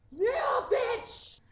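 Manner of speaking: high, punched mock cheer; triumphant yell. Imagining a scene for the viewers, high triumphant yell.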